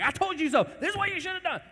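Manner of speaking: very high, loud gloat; an ungracious parent gloating; gloating told-you-so. Retold or imitated. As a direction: rub it in triumphantly. Imagined harsh parent.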